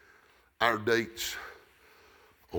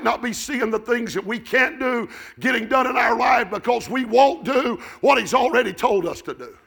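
loud, high revival preaching; full-force preaching; 14 dB louder than usual. Real, not acted. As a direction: shout the story out with driving urgency.

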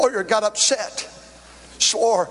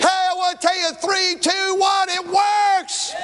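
slow, high, rising preacher's cry; full-force preaching; 5 semitones higher, much slower than usual. Acted. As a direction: drive it home like a revival preacher.

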